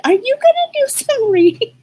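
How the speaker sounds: high-pitched imitation of grandma asking; grandma's voice. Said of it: "My grandmother's like".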